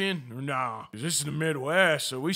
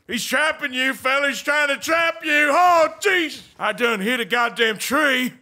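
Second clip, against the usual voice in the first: alarmed cowboy shout; 8 semitones higher, 5 dB louder than usual. Acted. Cowboy crashing his horse into a tree, alarmed shout.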